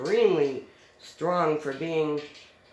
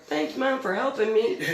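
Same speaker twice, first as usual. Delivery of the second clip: higher-pitched mock complaint; imitating indignant daughter; 6 semitones higher than usual. Daughter complaining to mom after being dragged, imitated indignantly. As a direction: play the put-out daughter. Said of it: "my daughter was like".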